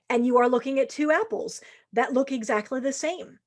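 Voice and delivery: usual speaking voice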